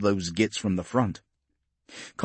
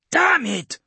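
sharp high angry shout; 6 dB louder than usual. A narrator's reading. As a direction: curse it out loud in the thick of battle. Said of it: "Hanlon shouted".

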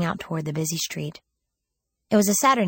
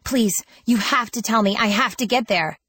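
higher, louder urgent plea; worked-up pleading; 5 semitones higher, 4 dB louder than usual. A narrator's reading. Girl begging the woman for directions, urgent and worked up. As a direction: beg insistently, all worked up. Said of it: "too worked up to explain".

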